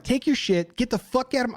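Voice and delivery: high, angry dismissal cut short; losing it. Retold or imitated. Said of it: "I lost it, dude, lost it".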